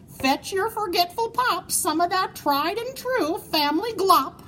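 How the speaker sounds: high, hearty proclamation; declaring. Acted. Moose declaring to his children, high and hearty. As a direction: announce it grandly to the kids. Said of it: "he declared".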